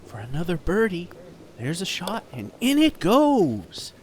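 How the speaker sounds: hushed TV golf announcer voice; golf commentator. Acted. Mock golf play-by-play.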